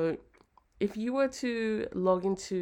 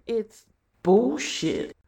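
exasperated; 5 semitones higher, 10 dB louder, much slower than usual. Real, not acted. Ranting about lame bio.

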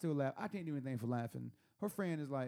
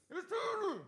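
muffled visitor voice; slower than usual. Retold or imitated. Visitor answering through the door, high distant-sounding call. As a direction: imitate someone calling high from behind a door.

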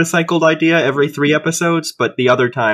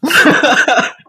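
high burst of laughter; 12 semitones higher, 4 dB louder than usual. Real, not acted. Laughs at blunt joke.

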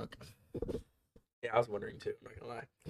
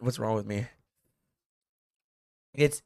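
usual speaking voice

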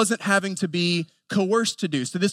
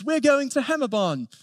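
put-on higher-pitched little boy's Aussie accent; Australian accent; 6 semitones higher than usual. Dad imitating his son playing upstairs, high Australian accent. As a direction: imitate a five-year-old copying Australian cartoon dogs. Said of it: "talking with an Australian accent".

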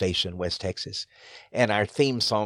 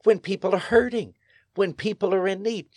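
preaching emphasis; 9 semitones higher than usual. Acted. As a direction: lift it like a preacher. Preaching build.

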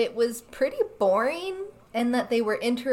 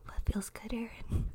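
ASMR whisper; 9 dB quieter than usual. Pretend. ASMR teasing continues.

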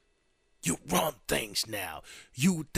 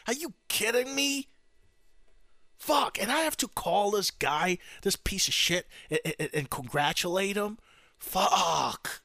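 higher-pitched disgusted griping; exasperated outburst; 6 semitones higher than usual. Acted. Outgoing president dreading the call to his successor, exasperated griping. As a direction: sputter in exasperation at the chore.

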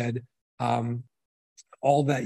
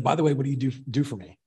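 usual speaking voice

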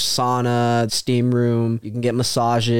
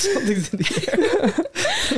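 laughing mock. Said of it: "he was nervous".

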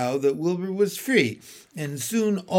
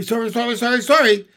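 high, rapid goose taunt; pitched-up squawk building louder; called warning; 7 semitones higher, 6 dB louder, much faster than usual. A narrator's reading. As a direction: call it out in a chattering string. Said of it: "called the goose".

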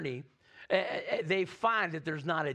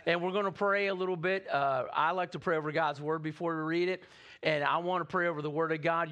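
normal talking voice

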